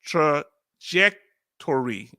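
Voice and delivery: trajectory sounded out "truh ... JEK ... tor-ee"; slow, deliberate trajectory with pauses between syllables; careful pronunciation. Shown as a demonstration. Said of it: "let me say trajectory".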